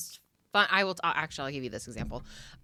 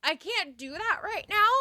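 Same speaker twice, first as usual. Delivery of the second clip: higher protesting reply; 11 semitones higher than usual. Retold or imitated. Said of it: "And I was like".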